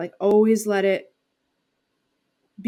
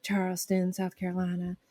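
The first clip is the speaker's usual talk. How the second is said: soft Charleston, South Carolina accent; Southern accent; 9 dB quieter than usual. Shown as a demonstration. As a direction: lightly slip into a quiet Charleston accent. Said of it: "couple of the different Southern accents".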